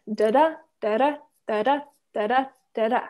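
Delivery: da-da pairs with the second beat stressed, like a heartbeat; rolling da-DA pulse; iambic heartbeat rhythm. Shown as a demonstration. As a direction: tap out the iamb, weak then strong. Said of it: "a two syllables that go da-da".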